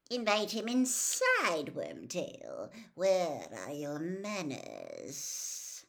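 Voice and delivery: icy master, dropping low and drawn out; cold voice. A narrator's reading. Cold master ordering Wormtail to admit the intruder, icy and mocking. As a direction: chide the servant with sinister courtesy. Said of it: "The cold voice was coming from".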